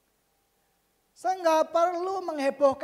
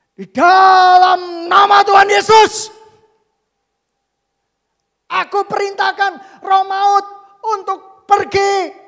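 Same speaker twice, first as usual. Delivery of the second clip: full-force, high prayer shout; re-enacted exorcism cry, long drawn words; commanding shout; 8 semitones higher, 21 dB louder, slower than usual. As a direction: roar it like casting out death. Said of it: "Saya teriak seperti itu".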